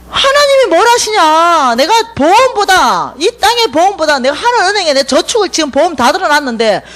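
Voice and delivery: mother preaching. Retold or imitated. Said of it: "우리 어머니".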